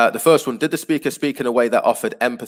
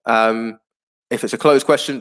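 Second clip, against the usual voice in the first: ordinary talk, nothing marked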